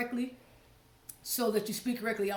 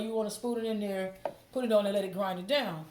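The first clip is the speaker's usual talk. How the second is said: ordinary talk, nothing marked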